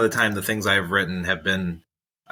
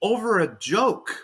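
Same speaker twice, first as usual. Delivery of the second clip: higher, slow, giddy amazement; mock thrilled; 8 semitones higher, slower than usual. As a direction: marvel at it like a fan. Imagined thrilled inner voice.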